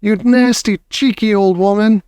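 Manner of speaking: high-pitched indignant scolding; angry shout. A narrator's reading. Father scolds the grandmother over the mouse, outraged shout. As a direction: tell her off in outrage. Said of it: "shouted Mister Jenkins".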